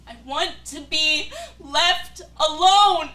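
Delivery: very loud, slow shout breaking into sobs; sobbing shout. Acted. Hurt lover screaming at her partner through sobs to be left alone.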